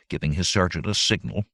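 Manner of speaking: usual speaking voice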